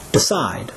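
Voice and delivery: Decide, low and falling on a stressed "cide"; stress on second syllable. Shown as a demonstration. Teacher asks learners to repeat Decide, second syllable stressed. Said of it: "stress is on the second syllable".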